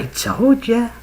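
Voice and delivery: high, drawn-out I-told-you-so; gleeful vindication. Retold or imitated. Teller to the others in line, gleefully vindicated. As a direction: stretch it out in retold triumph. Vindicated after woman speaks.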